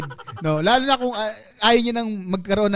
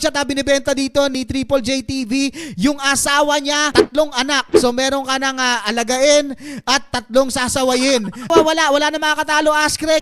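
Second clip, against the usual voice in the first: auctioneer; 5 semitones higher than usual. Pretend. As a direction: call the bids like an auctioneer. Mock auction continues.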